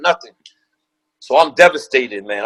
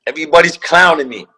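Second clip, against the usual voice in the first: normal talking voice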